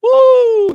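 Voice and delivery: high loud woo of emotion; emotional whoop. Real, not acted. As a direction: let out an overwhelmed woo. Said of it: "that movie".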